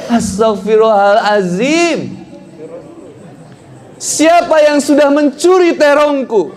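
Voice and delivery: high, drawn-out acted wail of outrage; wife's scream. Wife discovers the stolen eggplant, theatrical high scream. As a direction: cry out slowly and high-pitched, hammed-up. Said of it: "istrinya langsung teriak".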